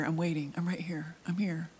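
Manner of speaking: hushed, breathy aside; a quiet, amused whisper of waiting; mock whisper. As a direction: whisper it like you're still waiting. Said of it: "laughingly one day said".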